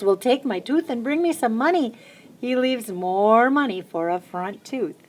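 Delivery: ordinary delivery, nothing marked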